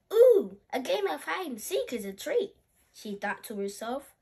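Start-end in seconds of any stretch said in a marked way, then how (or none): 0.1-2.6 put-on delighted child. Child delighted by game idea.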